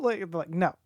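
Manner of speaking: normal talking voice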